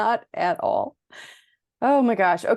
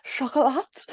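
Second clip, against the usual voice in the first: mock French; 5 semitones higher than usual. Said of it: "make fun of French people".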